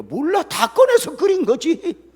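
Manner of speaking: put-on high, loud and slow, a child's blunt reply; slow, loud and high, a carefree child's shrug; kids answering loudly. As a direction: belt it out high like a kid who doesn't know. Kids' answer voiced in story.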